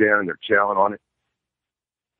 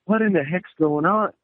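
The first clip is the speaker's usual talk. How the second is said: much higher, a startled put-on voice; baffled exclaim; 9 semitones higher than usual. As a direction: sound thrown by strange noises in the woods. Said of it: "And I'm like".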